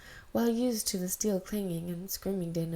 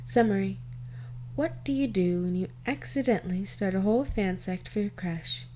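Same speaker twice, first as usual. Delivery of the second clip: loud, bright reading of the title card; title announcement; 2 semitones higher, 4 dB louder than usual. Acted. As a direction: announce the fic like a presenter. Title and summary read.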